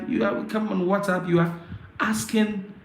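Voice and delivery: ordinary talk, nothing marked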